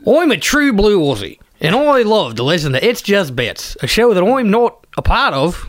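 broad mock Aussie; hammed-up Aussie bloke; Australian accent. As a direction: lay on a thick Australian accent. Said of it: "as well as doing Australian accents".

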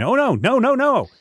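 high-pitched mock alarm; retold panic. Retelling a computer crash to co-hosts, high mock panic. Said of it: "have me going".

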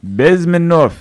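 name said in a hammed-up Russian accent, BEZ-men-ov. Shown as a demonstration. Host tries the name in a mock Russian accent. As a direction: roll out "Bezmenov" like a Russian. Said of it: "when you say it in a Russian accent".

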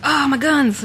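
high delighted gasp; excited. Retold or imitated. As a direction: gush over the guns. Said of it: "I was like".

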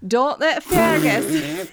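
loud, slow, laughing through it. Real, not acted.

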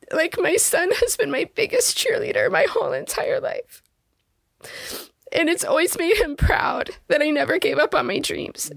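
fighting back tears while talking about her son; tearful, pitch lifted and unsteady; emotional, choking up. Real, not acted. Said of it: "I'm gonna, like, get emotional".

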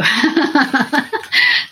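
high embarrassed giggle; a light flustered laugh; bashful laugh. Real, not acted. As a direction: laugh it off, flattered. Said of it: "I'm blushing now".

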